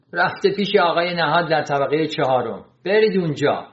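plain reading voice

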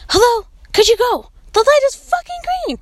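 impatient, climbing high and fast; exasperated high yell. Retold or imitated. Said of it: "I'm like".

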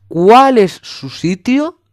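high, slow, fed-up "where is that?"; exasperated question. Retold or imitated. Son asking mom again, drawn-out exasperated.

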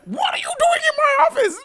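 high, loud, crying mock whine; whining mop boss. Mop boss whining at the intruder, high loud crying. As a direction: wail it like a petulant creature. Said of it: "It's like".

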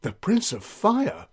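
shocked disbelief. Acted. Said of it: "The guards and ladies gasped".

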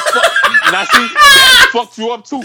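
high, loud words through laughter; wheezing laugh into words; laughing hard. Real, not acted. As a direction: talk while cracking up. Laughing hard while talking.

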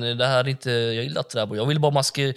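ordinary talk

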